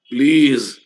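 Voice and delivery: loud exasperated please. Real, not acted. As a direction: plead loudly, fed up. Scolding students in chat.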